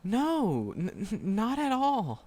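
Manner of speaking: high, loud stammering no; flustered denial. Acted. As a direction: blurt out the denial, tripping over it. Flustered denial of hating listener.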